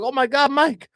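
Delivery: put-on high, loud Spanish-accented shriek; panicked Spanish neighbour. Spanish neighbour finding Mike fallen through his wall, high frantic shouting. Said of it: "into the fucking Spanish dude downstairs".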